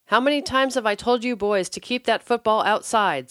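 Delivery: loud, high furious scream; mother yelling at her kids. A narrator's reading. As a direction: scream at the boys over the broken teapot. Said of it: "screamed Mary".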